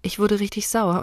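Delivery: ordinary delivery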